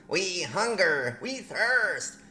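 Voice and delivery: high, slow, drawn-out goblin wail; goblin plea. Pretend. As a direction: wail it like a hammed-up hungry goblin. Reads aliens' message in goblin character.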